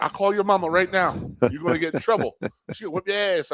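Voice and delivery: lightly taunting coach; mock threat. Coach warning the kid his mom will whip him, mild mock threat. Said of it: "He'd be like".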